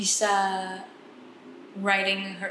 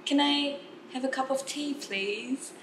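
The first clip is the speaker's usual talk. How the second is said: polite British order, slow and light; lightly voiced British café order, drawn out; British accent; 6 semitones higher, much slower than usual. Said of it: "saya tanya".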